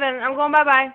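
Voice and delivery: ordinary talk, nothing marked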